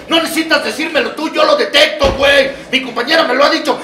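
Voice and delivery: ordinary talk, nothing marked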